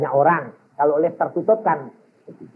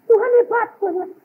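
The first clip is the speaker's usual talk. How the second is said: mock empty praise; much faster than usual. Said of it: "cuma sekedar dengar".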